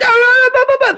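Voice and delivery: very high panicked babble; mock stuttering. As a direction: imitate a terrified underling tripping over syllables. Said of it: "They start stuttering".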